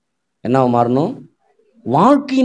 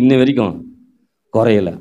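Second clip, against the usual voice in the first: ordinary talk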